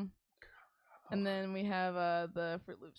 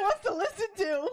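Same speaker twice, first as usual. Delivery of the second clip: laughing; 12 semitones higher, 7 dB louder than usual. Real, not acted. Mortified about mom hearing episode.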